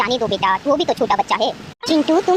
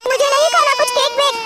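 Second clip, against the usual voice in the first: crying refusal; 6 semitones higher than usual. Acted. Sulking boy refuses cake crying.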